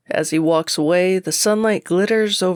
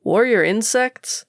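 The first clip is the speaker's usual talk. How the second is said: higher, mocking question; amused disbelief; 7 semitones higher than usual. Berserker mocks the gardener's warning with amused disbelief. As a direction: scoff at the idea with a rising question. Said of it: "the chuckle that explodes from my lips".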